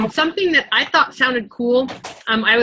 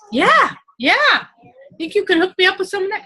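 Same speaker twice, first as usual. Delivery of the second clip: eager friend; 8 semitones higher than usual. Retold or imitated. Acting an eager friend's reply.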